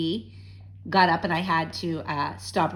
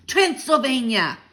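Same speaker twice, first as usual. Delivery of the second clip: louder, slow, triumphant; triumphant cry; 6 semitones higher, 7 dB louder than usual. A narrator's reading. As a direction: land the word like a winning card. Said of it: "he cried triumphantly".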